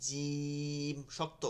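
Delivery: ordinary talk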